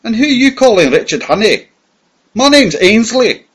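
high, loud and indignant, slowing on the name; rough tramp retort. Acted. Fugitive denying his name to the villain, rough indignant retort. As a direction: throw the name back at him like an insult. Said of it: "I said roughly".

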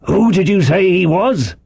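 high, insistent landlord; Long John Silver questioning. A narrator's reading. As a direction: press the question in character. Silver questions the boy sharply.

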